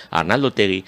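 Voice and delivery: normal talking voice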